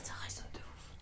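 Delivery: hushed awed whisper. Real, not acted. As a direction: breathe it under your voice. Whispering in echoing room.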